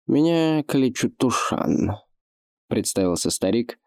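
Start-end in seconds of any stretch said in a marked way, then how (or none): 0.1-2.0 put-on lisping old orc. Old orc introduces himself.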